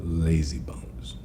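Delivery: hushed storyteller whisper. Acted. Teller whispering to listeners before the tale. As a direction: lean in and whisper the tease.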